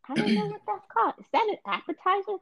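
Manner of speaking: high, fast host patter; game show voice. Acted. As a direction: rattle it off like a quiz host. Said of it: "I had perfect game show voice".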